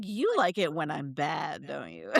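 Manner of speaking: sleazy come-on. Retold or imitated. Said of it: "And then he's like".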